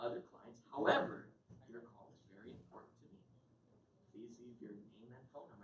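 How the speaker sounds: flat voicemail greeting. Retold or imitated. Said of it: "I'm using Marla's name".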